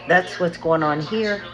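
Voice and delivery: normal talking voice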